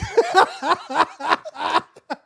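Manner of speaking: high, loud burst of laughter; real laughing fit. Real, not acted. Storyteller losing it at his own goodbye, loud high laughter. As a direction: crack up helplessly.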